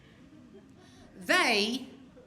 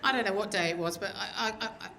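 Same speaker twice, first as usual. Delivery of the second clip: ordinary talk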